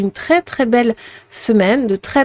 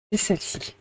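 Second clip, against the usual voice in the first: soft unvoiced whisper to herself; 12 dB quieter, faster than usual. Real, not acted. Card reader whispers to herself as she picks, hushed.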